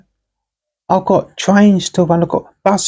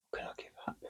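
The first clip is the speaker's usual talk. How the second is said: whispered; 31 dB quieter, much faster than usual. Acted. Pondering, repeated under breath.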